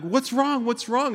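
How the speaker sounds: high-pitched, acted-out alarm; alarmed question. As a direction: retell a swimmer's startled question, voice jumping up. Panicked swimmer answers yelling lifeguard.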